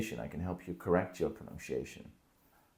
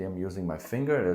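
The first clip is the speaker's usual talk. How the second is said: usual speaking voice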